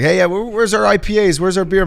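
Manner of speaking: high, loud, put-on voice of rowdy party dads; rowdy dad impression. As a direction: ham up a boisterous dad demanding beer. Imitating rowdy dads on the plane.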